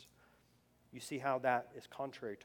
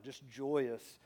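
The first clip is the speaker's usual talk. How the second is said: usual speaking voice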